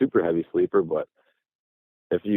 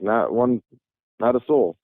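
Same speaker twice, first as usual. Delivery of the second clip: ordinary talk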